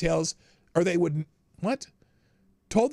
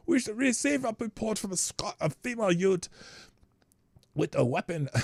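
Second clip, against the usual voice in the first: a failed, half-hearted Scottish accent, a bit higher; bad Scottish accent; 6 semitones higher than usual. Shown as a demonstration. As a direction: try a Scottish accent and mostly miss it. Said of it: "I can't do Scottish accent".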